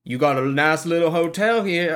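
mock Southern hotel guest; attempted Southern accent. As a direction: have a go at a New Orleans accent. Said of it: "I can't do that".

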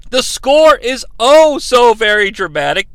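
ordinary talk, nothing marked

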